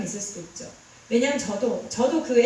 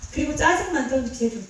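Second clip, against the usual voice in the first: usual speaking voice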